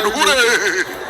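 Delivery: drawn-out mock cheer; imitated class shout. Class cheers after the stammered word, mock drawn-out shout. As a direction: stretch it like a class chorus. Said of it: "the whole class shouted".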